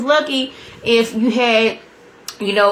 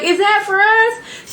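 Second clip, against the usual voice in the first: panicked question; 12 semitones higher, slower than usual. Retold or imitated. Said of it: "But I'm like".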